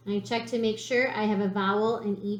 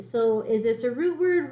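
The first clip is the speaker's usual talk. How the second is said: usual speaking voice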